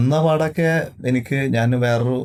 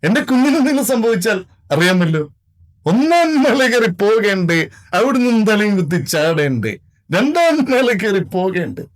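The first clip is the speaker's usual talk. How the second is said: higher, louder dramatic movie-dialogue impression of a veteran actor; mimicked film actor; 6 semitones higher, 3 dB louder than usual. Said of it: "എന്നാ ശബ്ദം ചെയ്യല്ലേ?". (ഉയർന്ന ശബ്ദത്തിൽ ഉച്ചത്തിൽ cinema നടന്റെ dialogue അനുകരണം)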